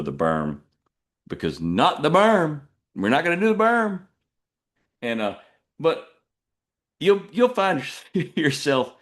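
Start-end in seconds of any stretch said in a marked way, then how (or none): 1.6-4.0 mock panic callback. Callback joke, raised voice. 7.0-8.9 laughing. Real, not acted. Laughing mid-sentence.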